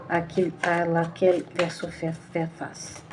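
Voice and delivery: sinks from soft speech into a whisper; solemn question to the cards, fading to a whisper. Acted. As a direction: let the question trail off into a whisper. Asks cards, voice dropping away.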